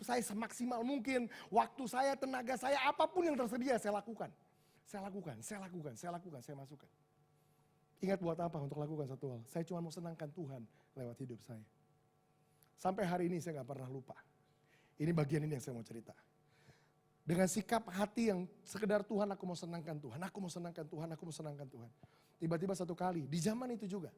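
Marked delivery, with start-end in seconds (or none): 4.8-6.9 quiet resolve, sinking lower each time; hushed repetition. Real, not acted. Preacher after listing all he gave, hushed and earnest. Repeats softly after building climax. 19.0-21.9 repeated plea fading lower and softer; heartfelt prayer. Retold or imitated. Preacher voicing his simple wish to God, fading tenderly.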